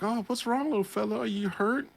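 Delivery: put-on high, sweet baby-talk voice; cooing to a small animal. The man soothing the hurt rabbit, high cooing. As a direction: coo it like talking to a bunny. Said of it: "He's like".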